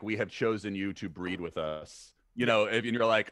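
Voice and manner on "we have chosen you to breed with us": slow, slightly lowered alien pronouncement; lightly voiced alien, measured and slow; alien visitor. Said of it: "like an alien coming down".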